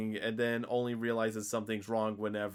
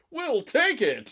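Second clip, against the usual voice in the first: high, loud, drawn-out cheer; hammed-up gleeful announcement; mock eagerness; 7 dB louder, slower than usual. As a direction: call it out like an oblivious, eager buyer. Mimics Matthew's oblivious eager cry.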